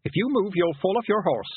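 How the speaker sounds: high, firm warning; trying to sound commanding. A narrator's reading. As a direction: pitch it up and warn with authority. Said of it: "trying to sound commanding".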